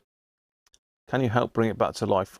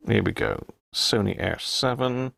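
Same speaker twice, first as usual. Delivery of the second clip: ordinary delivery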